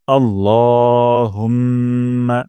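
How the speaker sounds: আল্লাহুম্মা very slow, the ল stretched one alif; slow Quranic recitation. Shown as a demonstration. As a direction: draw out আল্লাহুম্মা, holding the ল. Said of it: "আপনারাও আমার সাথে উচ্চারণ করবেন". (ল এক আলিফ টেনে আল্লাহুম্মা)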